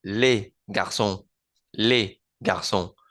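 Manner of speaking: les with the Spanish E, slow; les garçons, open E, silent S; plural les. Shown as a demonstration. Teacher modelling les for Spanish learners. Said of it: "el plural se pronuncia con la E del español".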